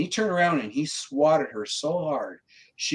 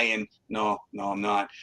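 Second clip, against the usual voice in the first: ordinary delivery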